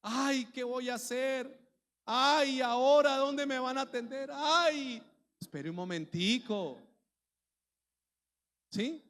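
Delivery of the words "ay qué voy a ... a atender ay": imitating a tearful, panicky church member, higher and louder; hammed-up lament, wailing "ay"; retelling someone almost in tears over healthcare, higher, louder mock wail; mock near-crying. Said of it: "Casi llorando".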